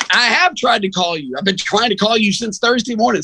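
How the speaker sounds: high, insistent protest; passionate. Retold or imitated. Radio host telling the coach he kept trying to call, passionate protest. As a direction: plead your case with heat. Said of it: "Some passionate [name] comes out".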